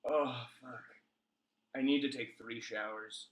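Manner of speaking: soft, low hungover groan. Real, not acted. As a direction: groan like the morning after. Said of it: "I'm so hungover".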